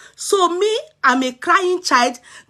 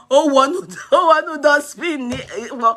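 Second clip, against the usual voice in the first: ordinary talk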